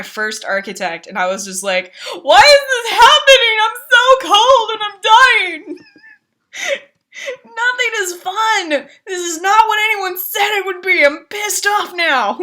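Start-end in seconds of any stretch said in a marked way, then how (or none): 2.2-5.8 high, loud crying whine; whiny mock wail. Whining about freezing in game. 7.4-12.4 whiny complaint. Retold or imitated.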